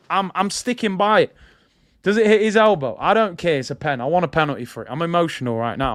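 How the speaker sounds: ordinary talk, nothing marked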